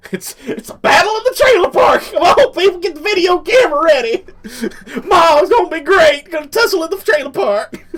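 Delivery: very high, loud trailer park onlooker; excited hollering. Acted. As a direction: whoop it up in a shrieking high voice.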